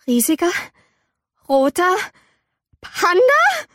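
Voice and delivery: halting words climbing to a high squeal; dawning shock. A narrator's reading. May sees a giant red panda in the mirror, dawning shock. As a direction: build word by word into disbelief.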